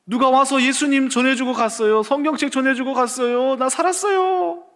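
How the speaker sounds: 높고 큰 목소리로 기뻐 외치는 아들; 살았다고 어머니께 흥분해서. Retold or imitated. 살아난 아들이 어머니께 외침. (어머니께 기쁨에 들떠 높게 외치는 목소리)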